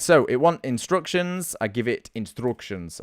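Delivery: ordinary delivery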